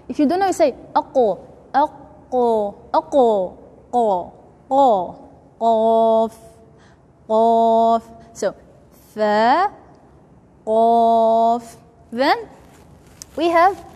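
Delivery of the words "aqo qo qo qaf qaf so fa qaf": "qaf" from the back, near the tonsil, louder; deep qaf. Shown as a demonstration. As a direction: push "qaf" deep into the throat. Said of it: "you have to make it deeper".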